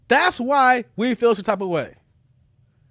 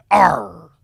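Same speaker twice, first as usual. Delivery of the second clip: growling arr, loud; gruff bark; 7 dB louder than usual. Acted. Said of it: "I'm barking like that".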